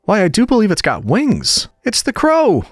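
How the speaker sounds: high, rising cry of alarm; alarmed shrill cry. A narrator's reading. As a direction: cry out in fright as the crow comes. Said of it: "Tweedledum cried out in a shrill voice of alarm".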